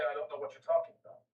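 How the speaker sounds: quick flat American denial; put-on American accent. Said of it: "stay in my American voice".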